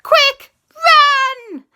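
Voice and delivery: very high, loud alarm cry; urgent shout. A narrator's reading. Telling everyone to flee the monster, urgent shout. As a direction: shriek a warning, stretching the last word. Mosasaurus attacks, urgent warning.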